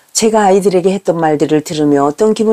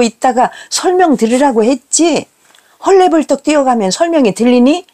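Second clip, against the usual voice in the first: higher-pitched acted scolding; nagging; 4 semitones higher than usual. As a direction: nag on and on like a cross mother. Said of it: "라며 잔소리를 해댑니다".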